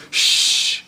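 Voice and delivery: long, sharp "shh"; hushing shush. Acted. Hiding brother to young David, urgent shushing. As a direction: shush someone while hiding. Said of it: "And his brother says".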